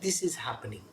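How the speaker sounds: unvoiced hush on this is happening; a sudden hushed question; whisper. Real, not acted. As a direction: drop to a whisper to pull them in. Guru drops to whisper.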